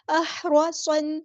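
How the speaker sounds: احرصن drawn out with a heavy, full ص; very slow chant of احرصن; tajweed recitation. Shown as a demonstration. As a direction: stretch احرصن very slowly. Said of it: "صاد ہمارا heavy letter ہے".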